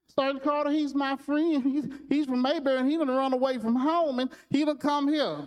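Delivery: put-on high, earnest child's voice; a boy nervously explaining to the sergeant. Opie's friend explains to sergeant.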